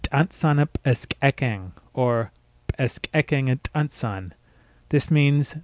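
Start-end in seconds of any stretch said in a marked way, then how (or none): 0.0-1.7 slow "Tantsan ep eskekeng" with popped t, p and k; ejective consonants. Shown as a demonstration. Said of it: "phrase you can say for practice". 2.8-4.3 slow "Eskekeng et tantsan" with popped k and t; ejective consonants. Shown as a demonstration. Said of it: "practice in all three ejective consonants".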